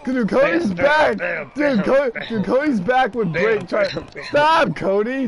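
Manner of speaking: high, urgent protest; agitated callout. Real, not acted. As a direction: call out the threat in alarm. Alarmed callout during game fight.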